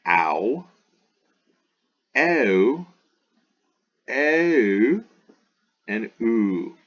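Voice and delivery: "I, a, oi, ui, ow, oo" sounded one by one with pauses; each diphthong drawn out as one gliding sound; diphthong drill. Shown as a demonstration. Greek teacher models the diphthongs for students, deliberate drill.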